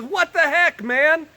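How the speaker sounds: high, loud indignant donkey; hammed-up donkey complaining to its rider; mock talking donkey. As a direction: squawk it like an outraged donkey. Donkey talks back, retold.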